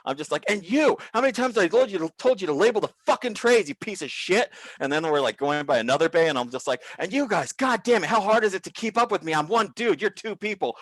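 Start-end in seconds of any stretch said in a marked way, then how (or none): 0.5-4.5 fast, high, loud furious tirade; screaming at coworkers. Retold or imitated. Pushed down the hall, he screams at a coworker in high fast fury. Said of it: "I'm just screaming at them". 7.0-10.8 high loud outraged yelling; screaming at coworkers. Retold or imitated. Passing another bay, he yells at two workers in high anger. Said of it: "I'm just screaming at them".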